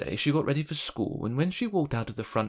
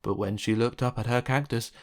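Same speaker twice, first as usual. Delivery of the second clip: plain reading voice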